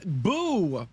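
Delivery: ordinary delivery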